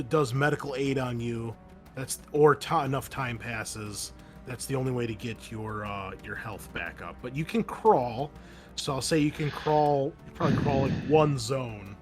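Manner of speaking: ordinary delivery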